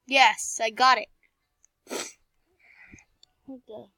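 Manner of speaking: ordinary talk, nothing marked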